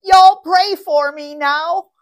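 high, loud, drawn-out Southern holler; Southern-accented cry. Retold or imitated. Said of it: "crying out in a charming Southern accent".

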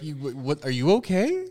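rising high baffled are you okay; confused, high and unsure; baffled concern. Retold or imitated. Lady facing a crying stranger, high and baffled. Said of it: "I would be like".

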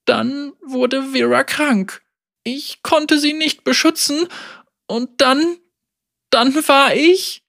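put-on high, trembling, breaking girl; a child breaking down. A narrator's reading. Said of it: "Zittern in ihre Stimme".

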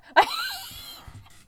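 very high, loud drawn-out squeal; shrieking squeal while pouncing; high-pitched scream. A narrator's reading. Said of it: "I screamed".

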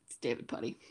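low, breathy impression of Puddy; deep David Puddy voice. Friend hearing his voice, low whispered Puddy impression. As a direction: drop low and hushed like Puddy.

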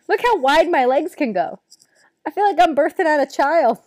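loud, laughing-edged brag; loud excited showing off. Real, not acted. Showing off comfy shorts.

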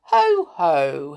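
loud, drawn-out wizard's catchphrase; pompous wizard. A narrator's reading. Wizard comes near the pixies, booming grandly. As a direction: boom it out like a gloating wizard.

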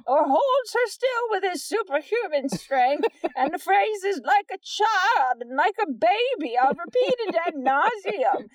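high, lively mocking read-aloud. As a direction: read it like a snooty reviewer. Continues the review in voice.

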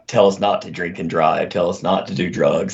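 ordinary talk, nothing marked

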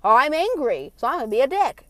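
loud, mocking imitation of an angry excuse. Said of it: "But everyone uses".

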